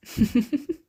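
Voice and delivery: light, rising chuckle; short laugh lifting at the end; amused chuckle. Acted. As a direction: chuckle indulgently at your guest. Amused before admitting they won't eat.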